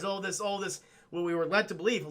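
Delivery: ordinary talk, nothing marked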